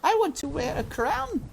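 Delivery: put-on high little girl's voice; high wishful girl's voice; Meg wishing.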